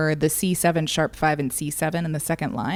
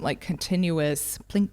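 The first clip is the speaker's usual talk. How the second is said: ordinary talk, nothing marked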